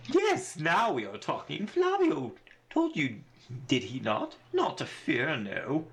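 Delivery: showy braggart with high, swooping pitch; self-satisfied boasting in a theatrical voice; pompous Flavio. Acted. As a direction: leap up high on the boastful bits.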